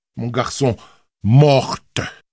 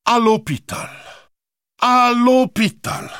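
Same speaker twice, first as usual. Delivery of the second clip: mocking; 10 semitones higher than usual. A narrator's reading. Uncle mocks the boy's answer.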